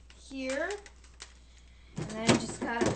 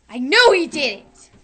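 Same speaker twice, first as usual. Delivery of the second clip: furious accusing yell, much louder; angry shout; 13 dB louder than usual. Pretend. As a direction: blast the accusation. Villain discovers theft.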